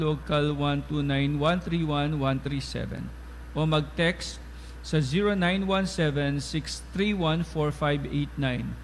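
ordinary talk

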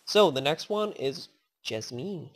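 usual speaking voice

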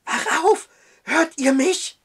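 high, loud panicked call; frightened cry. Acted. Matthew wakes the judge, high with fear. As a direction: shake him awake in terror. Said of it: "Obwohl seine Stimme hoch vor Angst war".

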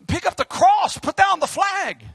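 loud, high, near-breaking preacher's cry; fiery shouting preaching. Real, not acted. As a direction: let the sermon burst out at full force. Preaching at full force.

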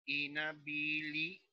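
dictated syllable division of "Inhabilitado". Shown as a demonstration. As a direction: say "Inhabilitado" one syllable at a time, pausing between. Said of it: "debiste separar en sílabas".

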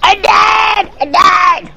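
real high-pitched screaming protest; frantic yelling. Real, not acted. Gamer protesting that their character is dead, frantic scream. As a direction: scream it out at the top of your voice. Game character has just died.

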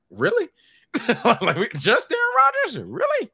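high-pitched squeak of disbelief; squeaky, exasperated questioning; incredulous disbelief. Retold or imitated. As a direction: voice leaps up, half laughing. Incredulous at Packers loss.

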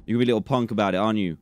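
ordinary talk

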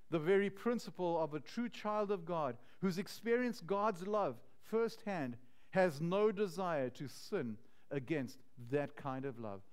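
ordinary talk

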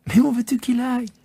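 put-on child laughing at the worry; laughing little prince. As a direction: tease the grown-up. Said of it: "un nouvel éclat de rire".

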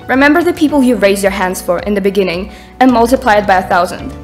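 ordinary talk

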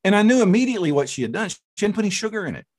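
ordinary talk

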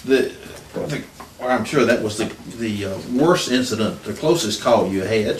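ordinary delivery

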